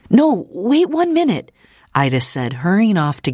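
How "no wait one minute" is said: high, urgent call to stop. A narrator's reading. Ida stops the departing visitor, urgent. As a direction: stop him quickly with a high voice.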